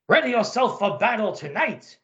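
put-on gruff German officer, German accent; comic-book Nazi colonel, German accent. Colonel speaks.

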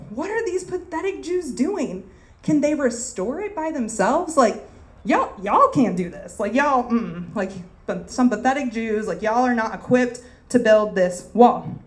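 mock the builders with scorn; sarcastic reading. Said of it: "sarcastic approach".

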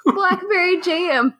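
high-pitched, can't stop laughing; helpless laughing. Real, not acted. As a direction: squeak the words out through uncontrollable laughter. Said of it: "I can't stop laughing".

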